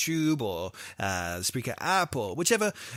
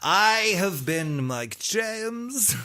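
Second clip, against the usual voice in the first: very slow, higher, louder sign-off; announcer sign-off; 8 semitones higher, 7 dB louder, much slower than usual. Acted. Host signing off to the listeners, slow showman sign-off. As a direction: stretch out the name like a showman. Leads the outro.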